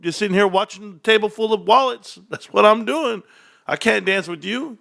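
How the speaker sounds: put-on a light high dismissive girl; high girl voice, lightly voiced. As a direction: brush him off, high and light. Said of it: "I'll be like".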